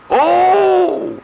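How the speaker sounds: high, loud cry of discovery; sudden exclamation. Real, not acted. Preacher struck by scripture, high exclamation. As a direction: struck by the verse. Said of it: "let me stop right there".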